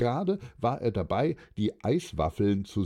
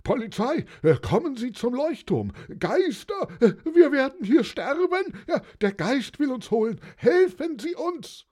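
very high, frantic cry for help; panicked yelling. A narrator's reading. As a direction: yell into the phone, stammering in terror. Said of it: "Er brüllte ins Telefon".

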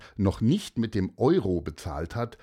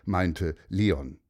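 plain reading voice

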